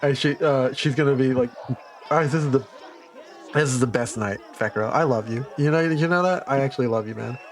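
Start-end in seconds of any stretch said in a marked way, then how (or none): 2.1-7.4 tipsy, sentimental slur; sloppy drunk confession. Acted. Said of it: "a weepy drunk".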